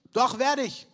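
high, loud protest; eager insistence. Acted. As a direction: Peter insisting he can do it. Petrus insisting against Jesus.

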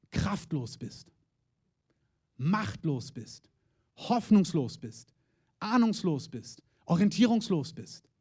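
slow, spaced-out words with long pauses; weighty listing. Real, not acted. Preacher has listeners picture their own situation, weighty listing. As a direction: let each word of the list sink in. Preacher slowly lists states of helplessness.

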